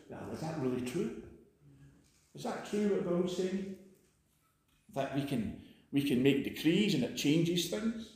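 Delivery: a quiet sceptic voicing doubts; doubting listener. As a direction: mutter your doubts under your breath.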